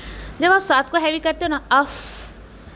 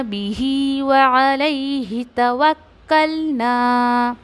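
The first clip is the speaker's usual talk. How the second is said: slow melodic tajweed recitation; chanted Quranic verse; much slower than usual. Shown as a demonstration. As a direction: recite slowly, drawing out each sound.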